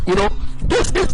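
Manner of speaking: ordinary delivery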